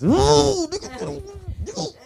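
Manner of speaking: very high, slow, strained; mock tense anger. Comic demonstrates getting mad, strained high mock fury. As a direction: squeeze it out shaking with rage. Showing how they get mad.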